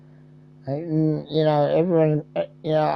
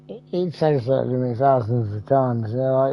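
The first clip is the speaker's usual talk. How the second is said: ordinary delivery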